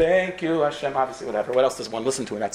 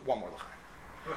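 drowsy murmur; 4 semitones lower, 16 dB quieter, much slower than usual. Acted. Tipsy husband asks again.

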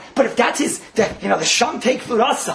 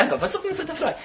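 mock wife voice; 7 semitones higher, 7 dB quieter than usual. Voiced wife complaining, high.

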